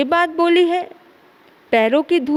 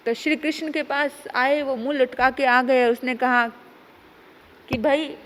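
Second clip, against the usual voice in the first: dejected report; 3 semitones lower, 3 dB quieter than usual. Acted. Uddhav returns dejected.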